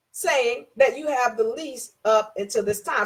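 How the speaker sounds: ordinary delivery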